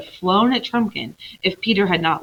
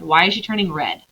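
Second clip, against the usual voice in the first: usual speaking voice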